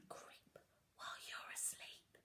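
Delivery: soft sneaky whisper; creeping whisper. A narrator's reading. Reading the robbers to children, creeping whisper. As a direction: whisper it like a prowling burglar.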